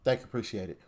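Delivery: asking nicely. Retold or imitated. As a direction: make it a quiet, courteous ask. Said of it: "maybe she asked him nicely".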